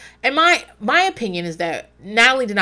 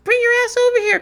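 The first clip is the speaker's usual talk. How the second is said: high, loud bossy call, much higher; mock shout, pitched way up and louder; retold yell; 5 dB louder, faster than usual. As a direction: imitate her yelling across the room. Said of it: "Natalie did yell".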